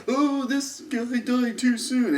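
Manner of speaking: high mock whine of mourning fans. Mocking fans who mourn the character, high whiny voice. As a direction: sneer it like a whiny fan. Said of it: "they were like".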